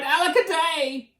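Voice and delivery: high, loud woe; hammed-up wail of despair; crying out. A narrator's reading. Drip left behind by family, loud high lament. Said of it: "And he cried".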